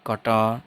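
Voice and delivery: कटार with a long open second vowel; silent final r. Shown as a demonstration. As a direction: say कटार, barely any r at the end. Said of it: "रे नहीं बोलेंगे last में". (कटार के अंत का र न बोलें)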